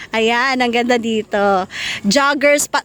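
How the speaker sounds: usual speaking voice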